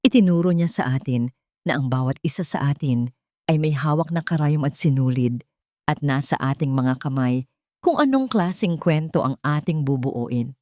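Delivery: plain reading voice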